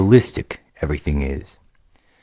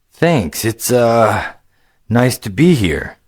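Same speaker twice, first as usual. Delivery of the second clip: put-on lightly raised, eager young gamer voice; a casual young player, a bit higher than the narration; upbeat young adventurer, higher pitched; young hero Greylick; 6 semitones higher, slower than usual.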